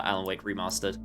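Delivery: ordinary talk, nothing marked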